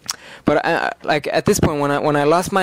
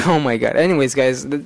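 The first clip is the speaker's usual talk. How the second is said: ordinary talk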